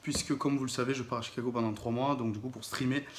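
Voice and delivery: ordinary delivery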